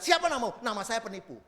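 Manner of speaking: proclaimed loudly and high; unashamed loud confession; loud declaration. Retold or imitated. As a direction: announce it boldly, no shame. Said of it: "dengan lantang dia berkata".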